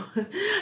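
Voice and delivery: sudden high squeal of a laugh; high burst of laughter. Real, not acted. Asking viewers if they've been ghosted, laughing high. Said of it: "And I'm laughing".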